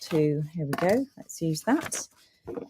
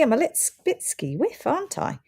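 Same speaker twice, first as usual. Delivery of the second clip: usual speaking voice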